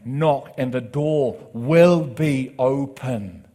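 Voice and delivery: loud, slow, drawn-out proclamation; full-force preaching. Acted. Preacher booming God's promise to the church, loud and slow. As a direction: proclaim each word as a promise.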